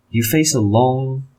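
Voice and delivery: normal talking voice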